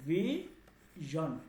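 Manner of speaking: very slow ভিশন, split "vee... zhan"; ভিশন sounded out slowly with zh; zh, broken in two. Shown as a demonstration. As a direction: stretch ভিশন into two separate syllables with a buzzed zh. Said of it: "এই উচ্চারণটা হবে".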